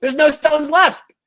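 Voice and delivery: sudden high, loud exclamation; excited outburst. Real, not acted. Joking that every stone got flipped, loud and high. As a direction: blurt it out way up top.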